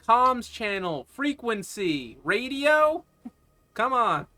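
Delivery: slow, high and loud, as if to an idiot; exasperated explaining. Acted. Stranger spells out radio terms to clueless pilot, exasperated. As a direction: spell it out impatiently, word by word.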